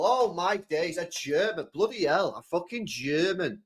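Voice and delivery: normal talking voice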